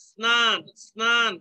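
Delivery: crisp s before the n in স্নান; dental s sound. Shown as a demonstration. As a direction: hiss the sn- opening of স্নান. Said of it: "দন্ত স এর উচ্চারণ পাওয়া যাচ্ছে".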